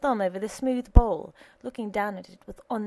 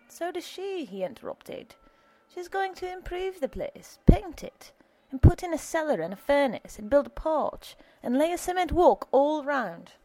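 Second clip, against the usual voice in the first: higher voiced alderman defending her; a firm old man setting things straight; Alderman Mooney; 7 semitones higher than usual. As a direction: alderman cutting in on the young husband.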